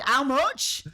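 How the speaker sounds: "How much?" in broad Yorkshire with a rising end. Retold or imitated. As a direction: ask "How much?" like a canny Yorkshire shopper. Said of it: "In true Yorkshire fashion".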